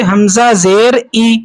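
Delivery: slow recital, ای as a clear "ee"; teacher spelling out حمزہ زیر ای slowly, ending in "ee"; ma'ruf vowel. Shown as a demonstration. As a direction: say ای with a pure long "ee" sound. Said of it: "اس کو کہتے ہیں معروف".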